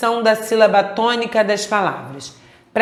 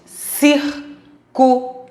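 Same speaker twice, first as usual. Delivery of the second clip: Círculo split CÍR, CU, LO, high and very slow; syllable by syllable; 5 semitones higher, much slower than usual. Shown as a demonstration. Teacher asking for the strongest syllable, syllable by syllable. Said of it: "Qual é a sílaba mais forte?".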